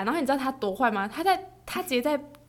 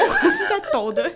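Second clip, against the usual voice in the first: laughing; 9 semitones higher, 7 dB louder, slower than usual. Real, not acted. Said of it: "而且我在发抖".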